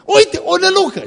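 high, loud cry of alarm; frightened disciples. Acted. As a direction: cry out as terrified disciples spotting a ghost. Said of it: "s-au înspăimântat și au zis".

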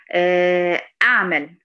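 أعمل with a wide-open jaw, loud; loud demo of أعمل. Shown as a demonstration. Teacher shows the wrong wide-jawed ع. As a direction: open the jaws fully on أعمل. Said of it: "مثلًا أعمل".

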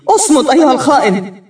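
high, loud angry yell; a bride screaming at her betrayer; furious shout. Acted. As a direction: bark it at the traitor. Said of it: "أصرخ به".

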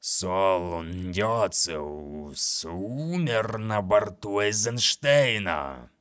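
slow, grating monster voice. Acted. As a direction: scrape the words out slowly. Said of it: "проскрежетал скрипучий голос".

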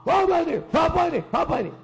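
high, sharp repeated demand; mock angry ranting. Preacher mimics people cursing and railing, high mock-angry rant. Acts out people hurling abuse.

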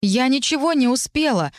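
high-pitched protesting outcry; mild shout, pitched high; crying out. A narrator's reading. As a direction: cry out in indignant denial. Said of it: "выкрикнула я".

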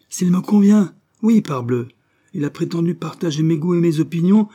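indignant outburst. A narrator's reading. Father protesting the suitor to his daughter, loud outburst. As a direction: burst out in mock outrage.

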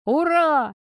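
high, loud cheer; joyful shout. A narrator's reading. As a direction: cheer it out at full voice. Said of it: "кричал Тимоха".

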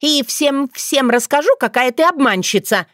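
put-on high, offended girlish threat; indignant threat. Dunya leaving and threatening to expose the yaga, indignant.

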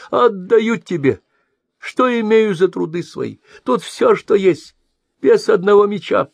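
put-on high, loud pleading old trader; whining blind merchant. A narrator's reading. Merchant pleads with the mercenary, whining. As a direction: plead that this is all you have.

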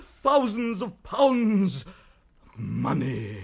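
slow, savoured proclamation; theatrical, drawn-out delight; grand and slow. Acted. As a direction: roll out the riches slowly. Grandly savouring his fortune.

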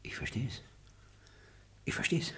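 sly whispered understanding; knowing whisper. Acted. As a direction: murmur it knowingly. Repeated knowing mutter.

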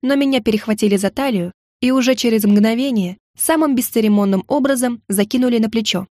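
ordinary delivery

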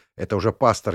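ordinary delivery, nothing marked

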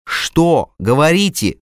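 the doctor demanding answers from the father; pained cry. A narrator's reading. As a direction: cry out in pain and urgency. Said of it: "выкрикнул я болезненно".

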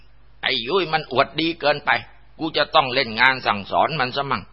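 high-pitched voice of a possessing spirit; spiteful ghost. Acted. Dead man's spirit speaking through Yui, spiteful high voice.

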